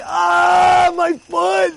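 high, drawn-out cry of pain; acted pained outcry. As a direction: wail like someone who just hurt his foot. Acts out man stepping on nail.

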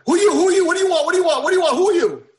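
high, loud repeated demands; startled panic. Acted. Prince startled by intruder, shouting high and scared. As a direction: jump in fright and shout who are you. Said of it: "the prince was like".

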